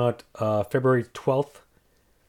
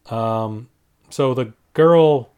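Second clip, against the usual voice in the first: ordinary talk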